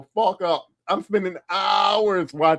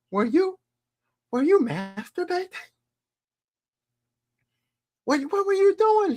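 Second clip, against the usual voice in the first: prying mom. Retold or imitated. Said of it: "and she's like".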